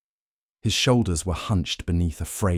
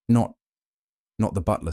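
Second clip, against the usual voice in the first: a jolted, higher "Not" that breaks off, then the plain "not the butler"; hesitant false start; 4 semitones higher than usual. A narrator's reading. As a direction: catch yourself on the first word, then restart. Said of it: "The man hesitated".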